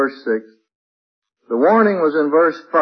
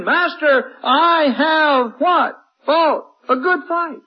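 higher, ringing preacher's plea to the Master; preaching at full force; 14 semitones higher, 4 dB louder than usual. Acted. Preacher voices a believer reporting to the Master, fervent and rising. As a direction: rise and testify, slow on the last vows.

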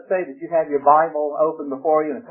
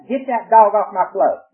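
higher, louder Georgia mountain twang; Georgia mountain accent; 6 semitones higher, 5 dB louder than usual. Acted. Preacher acting out mountain folk for a laugh, Georgia mountain accent. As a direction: Georgia mountain accent with floor as one-syllable flow. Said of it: "that's the mountain".

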